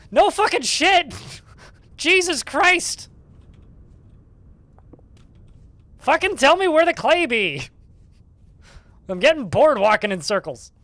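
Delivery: loud, high, laughing rant; exasperated yelling at chat. Real, not acted. Streamer yelling at chat after hours hunting clay, loud and laughing. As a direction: blow up at chat in real frustration, half laughing. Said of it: "Apologies for my inappropriate outburst".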